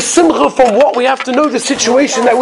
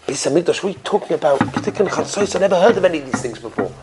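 low, hushed puzzlement; soft, mumbled confusion of a simple innkeeper; acted bafflement; 4 semitones lower, 7 dB quieter than usual. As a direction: play him lost, softer and lower. Ignorant innkeeper baffled by the rabbi's words.

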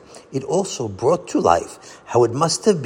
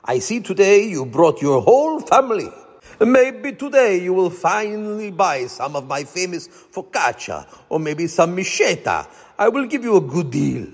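put-on high, heavy Italian accent; 8 semitones higher than usual. A narrator's reading. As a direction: sell it like a cheerful Italian baker. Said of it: "he called in a heavy Italian accent".

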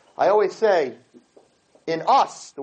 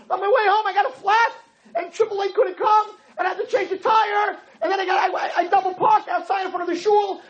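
much higher, hammed-up yelling rant; wife escalating her list of woes, much higher and yelling; outdone wife rant; 10 semitones higher than usual. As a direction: pile up the disasters, topping him. Wife topping husband's bad day.

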